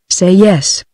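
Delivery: ordinary delivery, nothing marked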